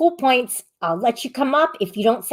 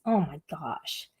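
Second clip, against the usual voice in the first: ordinary delivery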